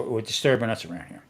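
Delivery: ordinary talk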